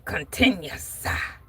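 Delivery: lightly voiced preacher with a tacked-on "ah"; a hint of a preacher's punctuating gasp. Host correcting herself like a reverend, lightly preacherly. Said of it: "like Reverend Archibald Turner".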